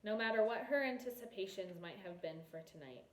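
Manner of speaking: ordinary delivery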